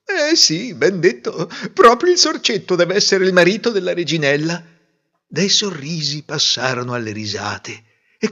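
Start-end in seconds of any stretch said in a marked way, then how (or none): 0.0-4.7 high, eager courtiers humouring the king; fawning agreement. A narrator's reading. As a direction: smile along to please the king. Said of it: "sorridevano e dicevano". (invitati compiacenti, voce alta)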